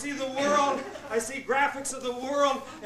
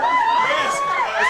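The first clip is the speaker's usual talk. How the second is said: high squeal; 9 dB louder than usual. Acted. Squealed aside.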